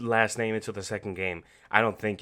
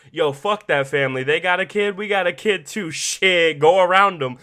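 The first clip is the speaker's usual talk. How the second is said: mock desperate Joel; 5 semitones higher, 8 dB louder than usual. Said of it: "Joel shows his true colors through desperation".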